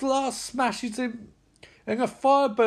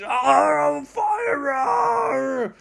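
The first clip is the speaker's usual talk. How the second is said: loud, high, drawn-out mock scream; hammed-up agonised yell; burning villain scream; 7 semitones higher, 3 dB louder, much slower than usual. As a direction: howl as if on fire. Voicing villain screaming on fire.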